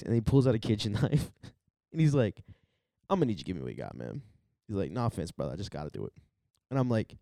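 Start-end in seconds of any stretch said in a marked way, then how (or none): 3.1-4.2 low, softer mock hold-up demand; understated robber, pitch dropped; imitated drugged mugger. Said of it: "clearly on heroin". 4.9-6.1 low, soft apologetic robber; imitated drugged mugger. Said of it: "clearly on heroin".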